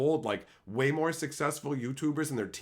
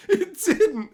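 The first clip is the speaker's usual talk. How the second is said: very high and louder, cracking up; louder laugh; 10 dB louder than usual. Real, not acted. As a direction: punch it while laughing. Laughing at surprise success.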